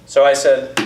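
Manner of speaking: usual speaking voice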